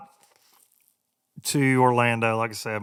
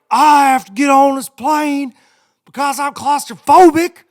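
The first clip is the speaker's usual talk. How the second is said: high, loud, slowed yell of an irate passenger; mock outraged passenger screaming; screaming demand; 13 semitones higher, 12 dB louder than usual. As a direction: shriek it at the lady like you're owed the plane. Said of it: "he's, like, screaming at this lady".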